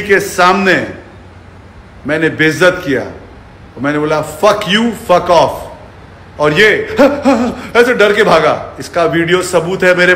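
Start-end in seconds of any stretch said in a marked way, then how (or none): none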